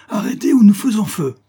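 loud, high shouted threat; cri de menace. A narrator's reading. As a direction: shout a warning before firing. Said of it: "cria une voix qui allait se rapprochant". (sommation criée, forte et aiguë)

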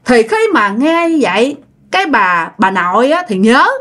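normal talking voice

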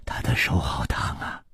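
ordinary delivery, nothing marked

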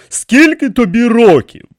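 high, loud repeated question; asking in disbelief. A narrator's reading. King questions the priest's age, incredulous. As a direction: ask it firmly, wanting a real answer. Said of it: "перепитав він".

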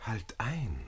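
hushed, breathy plea to stop; calm her down in a whisper; urgent whisper. Acted. Flüstert beschwichtigend. (eindringliches Flüstern)

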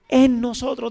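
loud answer. Shown as a demonstration. Pastor drilling the congregation, loud reply. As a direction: call the answer out strongly. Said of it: "¿La palabra dónde tiene que estar?".

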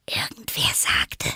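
breathy whisper; Alice whispering. Acted. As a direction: whisper it to her. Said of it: "flüsterte Alice".

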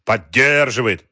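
loud, drawn-out mocking echo; mocking mimicry. A narrator's reading. Wolf parroting Galkin's excuse, mocking. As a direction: stretch the word out slowly to mock him. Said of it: "передразнил его Вольф".

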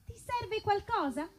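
normal talking voice

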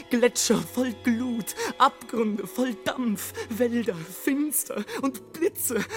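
high, ringing, building rapture; ecstatic declamation. Acted. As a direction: proclaim each image in mounting exaltation. Ecstatic catalogue of the stormy Alps.

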